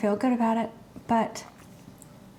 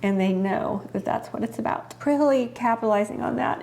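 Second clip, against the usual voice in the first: usual speaking voice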